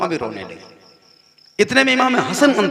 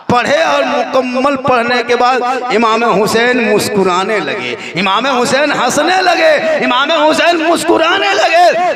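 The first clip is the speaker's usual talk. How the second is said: building to a higher, louder sermon call; rising preaching; 6 semitones higher, 5 dB louder, slower than usual. Acted. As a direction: lift the crowd toward the climax. Preaching at full force.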